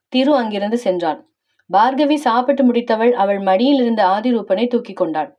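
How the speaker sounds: plain reading voice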